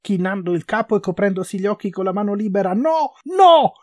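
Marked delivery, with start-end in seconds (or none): 2.7-3.7 high, very loud screamed refusals; rising cries of horror; terrified shouting. A narrator's reading. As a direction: scream each word in panic. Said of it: "coprì la sua voce".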